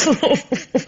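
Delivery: a short, high burst of laughter; amused laugh. Acted. The inspector laughing at the maid's retort, a high amused burst. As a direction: laugh it off, tickled by her cheek.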